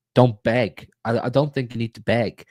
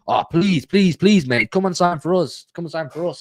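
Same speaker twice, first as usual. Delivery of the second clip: pleading, pitch up on please; mock begging; 7 semitones higher than usual. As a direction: ham up a desperate beg to a player. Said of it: "But I don't believe in".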